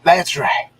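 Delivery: laughing shout. Real, not acted. Cohost shouts back laughing.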